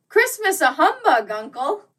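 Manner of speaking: high, loud disbelieving cry; incredulous. A narrator's reading. Nephew protesting his uncle's humbug, high and incredulous.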